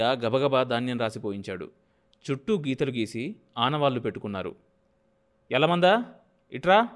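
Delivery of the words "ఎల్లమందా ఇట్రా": loud, higher shout across the yard; calling out. A narrator's reading. Landlord calling Yellamanda over, loud shout. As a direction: summon him with a sharp call. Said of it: "అని అరిచాడు".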